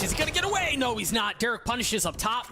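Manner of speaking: rising excited caster call; excited play-by-play. Acted.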